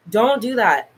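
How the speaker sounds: ordinary talk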